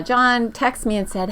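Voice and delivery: ordinary talk, nothing marked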